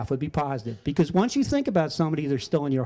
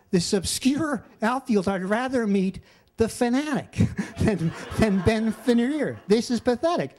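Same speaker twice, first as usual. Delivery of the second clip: higher, scornful complaint, half laughing; mock scorn; 5 semitones higher than usual. Telling his friend Saf he'd rather meet the mascot, with mock scorn. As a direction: sneer at meeting a lesser player.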